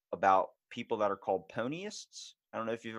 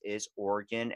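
ordinary talk

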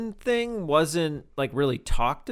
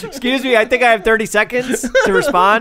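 high, loud mock-debate protest; laughing mock objection; 8 semitones higher, 8 dB louder than usual. Real, not acted. Host claiming time to respond, laughing mock indignation. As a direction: demand your turn while laughing.

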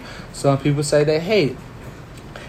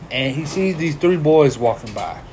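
normal talking voice

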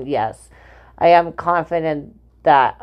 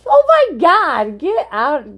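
exasperated exclamation; 10 semitones higher, 4 dB louder, slower than usual. Real, not acted. Reacts to crude remark.

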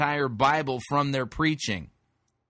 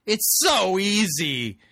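sing-song sarcasm, pitched well up; mocking echo; 6 semitones higher than usual. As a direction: parrot the advice with mock enthusiasm, punching high on the key word. Mockingly echoes the preacher's easy advice.

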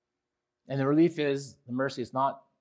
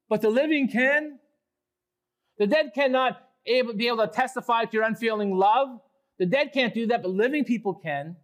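higher, louder insistent questioning; psalmist challenging God; 8 semitones higher, 3 dB louder than usual. Acted. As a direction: hammer each point home to God. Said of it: "sarcastic, rhetorical questions he puts to God".

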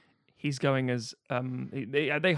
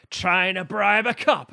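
slow, louder mock Irish accent; Irish cop quote; 3 semitones higher, 5 dB louder, slower than usual. Quoting the film's Irish cop, slow mock Irish accent. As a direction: lean into a stage-Irish cop sneer. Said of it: "Here's a quote".

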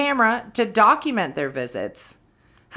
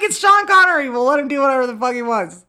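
high, loud mock studio exec, quick; mock producer; 6 semitones higher, 6 dB louder, faster than usual. Imagining filmmakers shrugging off Connery's accent, mock high excited voice. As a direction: toss it off like a starstruck producer. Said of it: "they're just like".

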